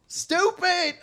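high, loud mocking country echo; mimicked country accent. Co-host gleefully repeating the jab, loud country mimicry. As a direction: parrot it back high in country twang. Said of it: "He said it in the country accent".

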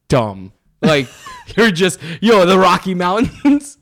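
slow, loud disbelief through laughter; cracking up at a dumb coworker; laughing. Retold or imitated. Teller mocking his coworker's blunder, laughing. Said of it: "And I'm like".

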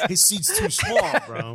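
teasing his co-host about the seat, laughing. Real, not acted. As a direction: laugh your way through the joke. Joking about co-host's seat.

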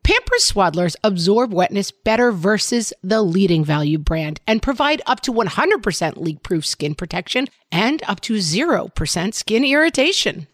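upbeat sponsor read, loud and bright. Acted. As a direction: sell it like a radio ad.

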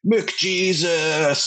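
high, stretched-out fan holler; mock drunken yell. Drunk Edmonton fans yelling at each other, mock holler. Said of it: "all they would yell at each other".

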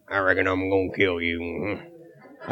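a deadpan low drifter threat; Sling Blade voice. Retold or imitated. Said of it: "You sound like Sling Blade".

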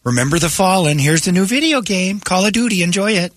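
sarcastic ad voice. Pretend. Mocking a cynical holiday ad.

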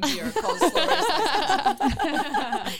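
laughing through it, slow and high; giggly, drawn-out reveal. Real, not acted. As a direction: burst out giggling over the name. Laughing while telling the twist.

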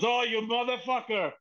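high-pitched comic indignation; mock outraged protest. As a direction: protest like the butt of a prank. Pat discovers the prank, protests.

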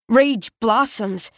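ordinary delivery, nothing marked

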